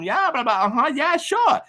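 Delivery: rising, high-pitched fake agreement; playing along. Driver stalls while slipping into first, playing along. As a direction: pitch climbing as you humor them. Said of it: "just talking back to him".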